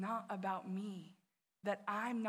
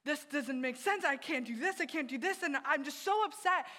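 higher and louder, frustrated despair; stuck in quarantine at Christmas, retelling her upset friend in mild frustration; 5 semitones higher, 6 dB louder than usual. Retold or imitated. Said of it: "she was like".

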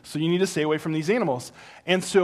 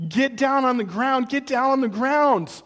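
urgent parent command; 8 semitones higher, 5 dB louder than usual. Pretend. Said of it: "So if I tell you".

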